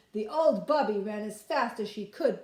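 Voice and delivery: ordinary delivery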